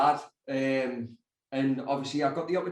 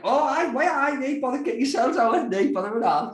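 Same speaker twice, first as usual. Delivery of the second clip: eager yes; 9 semitones higher, 4 dB louder than usual. Retold or imitated. Retold eager reply to producers.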